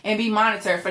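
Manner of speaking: ordinary talk, nothing marked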